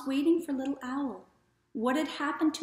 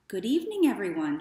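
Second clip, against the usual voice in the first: cheerful little owl; 5 dB louder, faster than usual. Acted. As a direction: call out good evening to the whole wood. Owl greets the woods.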